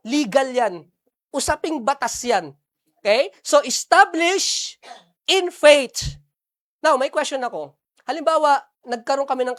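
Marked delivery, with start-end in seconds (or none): 3.4-6.2 slow, loud, lifted emphasis; slow and weighty on establish; emphatic. Shown as a demonstration. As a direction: land the key word deliberately. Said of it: "key term, slowed".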